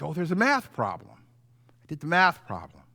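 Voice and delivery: ordinary delivery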